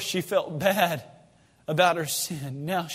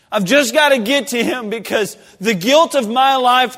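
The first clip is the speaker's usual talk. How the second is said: desperate plea; 5 semitones higher, 8 dB louder than usual. Acted. Sinful woman pleads to reach Jesus.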